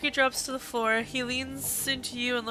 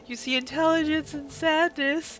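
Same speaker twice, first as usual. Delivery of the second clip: choked up reading; 6 semitones higher, 3 dB louder than usual. Real, not acted. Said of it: "reading makes me cry".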